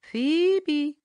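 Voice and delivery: high, whistled bird note; soft whistled phoebe. A narrator's reading. Farmer Brown's boy whistles Tommy's love note, softly. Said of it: "whistled Farmer Brown's boy again".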